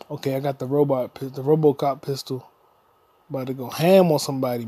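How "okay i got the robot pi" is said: ordinary delivery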